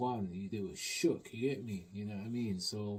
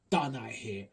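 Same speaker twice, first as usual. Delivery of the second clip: usual speaking voice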